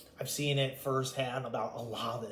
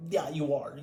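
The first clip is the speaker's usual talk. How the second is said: ordinary delivery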